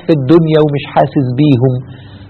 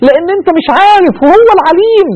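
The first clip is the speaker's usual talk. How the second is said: fervent emphasis; 12 semitones higher than usual. Real, not acted. Preacher stresses God's knowledge.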